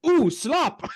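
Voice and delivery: very high, delighted squeal; quoting Wiggum eating slop; mock Wiggum delight. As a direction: coo over the slop.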